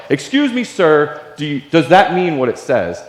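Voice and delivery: overeager excited question. Retold or imitated. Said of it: "Like, I just jumped out of my truck".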